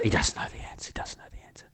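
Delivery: urgent whispered aside; barely audible, repeated under the breath; hushed whisper. Acted. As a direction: whisper it as a secret panic. Inside the panicking cadet's head.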